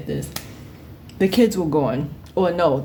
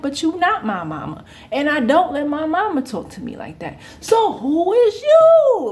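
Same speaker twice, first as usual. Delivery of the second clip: sassy comeback; 9 semitones higher, 3 dB louder than usual. Retold or imitated. Teen talking back to the older coworker, sassy and loud. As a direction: talk back with growing attitude. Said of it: "I'm like".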